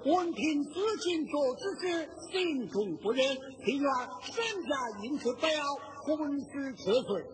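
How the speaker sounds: sung-spoken petition in opera style; petition recital. Acted. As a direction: chant the plaint like a stage recital. Reads out the petition. (戏曲念白式的诉状朗诵)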